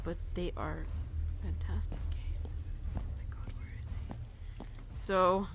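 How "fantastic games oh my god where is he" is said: whispered, slowing right down; hushed, drawn-out whisper; tense whisper. Real, not acted. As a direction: whisper warily while searching for a threat. Nervously hunting for the monster.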